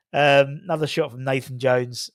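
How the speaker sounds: ordinary talk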